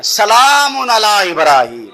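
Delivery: slow, loud, drawn-out سلام علی ابراہیم; سلام علی ابراہیم called out slow and loud; shouted recitation. Shown as a demonstration.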